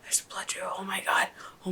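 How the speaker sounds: awed hushed whisper. Real, not acted. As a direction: breathe it out in awe. Whispering while watching.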